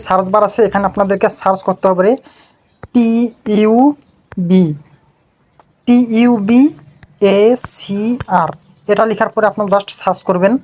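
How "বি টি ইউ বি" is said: ordinary delivery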